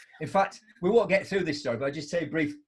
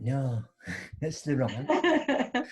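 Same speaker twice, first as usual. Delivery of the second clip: laughing rebuke; slower than usual. Retold or imitated. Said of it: "I went".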